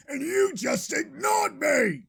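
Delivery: high, hurt shout; aggrieved outburst. Acted. Host accuses his producer in a high, aggrieved shout. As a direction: throw the complaint back, pitched high. Acted quarrel over music.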